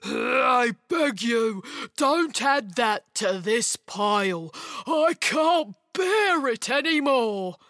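slow, put-out creature grumble; drawn-out grumbling plea; grumbling ogre. A narrator's reading. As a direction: beg wearily in a grumbly ogre voice. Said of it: "and grumbled".

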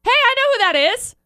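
spotting a familiar face, calling out; excited hey, I know that guy; excited recognition. Retold or imitated. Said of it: "someone was like".

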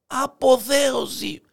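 high, stretched exultant cry. Acted. Hare in the jungle proclaiming his ecstasy, crying out. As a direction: sing out the word, slow and high. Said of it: "Και φωνάζει".

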